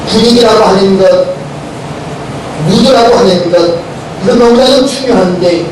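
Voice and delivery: normal talking voice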